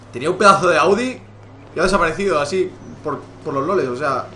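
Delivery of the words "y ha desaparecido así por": ordinary talk, nothing marked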